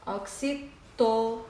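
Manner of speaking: soft ok-si... to... na, with gaps; syllable by syllable. Shown as a demonstration. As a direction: break up "Oxítona" as if writing it. Sounding out while writing.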